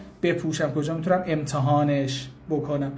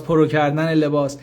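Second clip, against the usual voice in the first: ordinary talk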